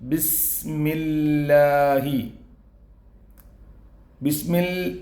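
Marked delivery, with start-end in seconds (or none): 0.1-2.2 ബിസ്മില്ലാഹി drawn out slowly, lips pressed on ബ; ബിസ്മില്ലാഹി slow and careful, each letter clear; over enunciating. Shown as a demonstration. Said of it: "ബിസ് എന്ന് പറയാതെ ബിസ്".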